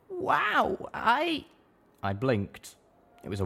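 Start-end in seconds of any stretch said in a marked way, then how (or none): 0.1-1.5 high, slow, surprised little mare; a small pony caught off guard; young mare. A narrator's reading. Littlepip voiced.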